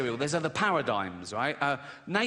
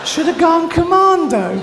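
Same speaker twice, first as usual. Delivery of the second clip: mocking repeat; 13 semitones higher, 15 dB louder than usual. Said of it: "mocking the friend's advice".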